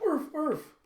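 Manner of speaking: very high, loud barking imitation; robot dog barks. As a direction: yap excitedly like a robot dog. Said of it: "he's like".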